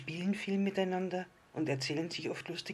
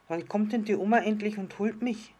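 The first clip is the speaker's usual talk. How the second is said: put-on little child voice; 7 semitones higher, 7 dB louder than usual. A narrator's reading. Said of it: "fragte das kleine Sterndl".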